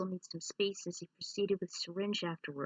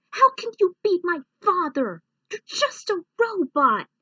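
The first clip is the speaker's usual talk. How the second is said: much higher and louder, shouting; furious denial at a machine; angry disbelief; 11 semitones higher, 12 dB louder than usual. Acted. Clank shouting at the robot who claims to be his father.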